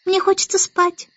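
put-on high, small child voice; sleepy baby Annabel. A narrator's reading. Baby Annabel wants to sleep, high little voice. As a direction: beg off in a baby voice.

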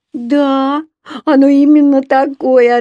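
put-on high, loud childish answer; little girl's voice. A narrator's reading. Еля confirming the drawing to mama, little girl's voice.

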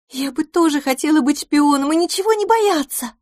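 мечтательно вздыхая, высоким девичьим голосом; вздохнуть о несбыточной мечте; со вздохом. A narrator's reading. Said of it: "вздохнула Пипина". (мечтательный вздох, высоко)

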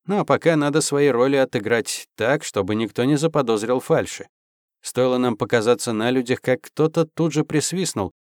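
usual speaking voice

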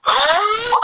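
عو held long on a high pitch; madd letter pointed out. Shown as a demonstration. Teacher pointing out the long vowel, high and drawn out. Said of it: "أدي حرف المد أهو".

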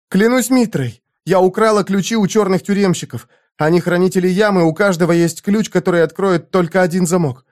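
put-on a trembling harem girl pleading; frightened young woman. A narrator's reading.

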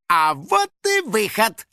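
high, drawn-out happy exclamation; delighted. Acted. Finik spotting the back door, delighted. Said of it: "обрадовался Финик".

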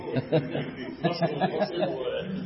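soft real laugh; real laughter. Real, not acted. Class laughing at the sheikh's joke, softly.

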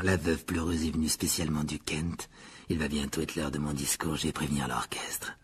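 low, soft, self-important host; Nick, proud. A narrator's reading. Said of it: "dit Nick fièrement". (fierté discrète, voix grave)

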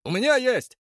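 high excited shout from the crowd. Acted. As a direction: yell from the back of the crowd. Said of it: "крикнул кто-то из зрителей".

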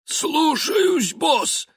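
high wheezing servant; wheezy voice. Acted. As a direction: wheeze it out eagerly. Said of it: "просипел мусорный человек".

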